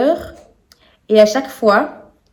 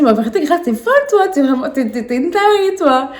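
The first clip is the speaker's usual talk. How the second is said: higher, louder teasing scold; half-laughing self-mockery; 5 semitones higher, 4 dB louder than usual. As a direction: call yourself crazy, laughing. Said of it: "je me dis".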